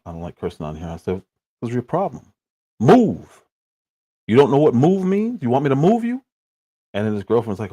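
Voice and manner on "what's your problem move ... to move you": loud, high angry yelling at a man blocking the car; retold yell. Driver yelling at a man blocking the car, loud and high. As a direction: bark it out like you're about to get out of the car. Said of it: "I yelled".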